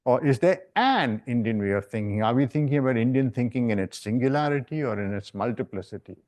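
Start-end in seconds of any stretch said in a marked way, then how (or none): 0.2-2.2 "an" lifted and fully sounded as the key word; stress on AN. Shown as a demonstration. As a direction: lean hard on the article "an". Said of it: "emphasizing these particular words".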